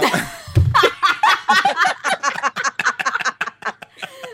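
high, loud burst of laughter; big laugh. Real, not acted. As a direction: crack up loudly.

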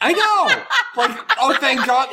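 high giggly voice; laughing. Real, not acted. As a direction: laugh through it. Laughing at boombox scene.

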